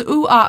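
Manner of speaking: quick, high "Ooh-ah" with no consonants; toddler's incorrect vowels. Retold or imitated. Child says it a third time, "Ooh-ah" high with wrong vowels. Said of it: "If he says".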